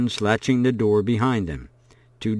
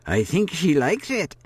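put-on higher, pleased old woman voice; amused old woman; 7 semitones higher than usual. A narrator's reading. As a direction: chuckle it fondly as the hostess.

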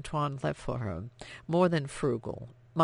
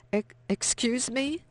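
shocked stammer; 9 semitones higher than usual. Acted.

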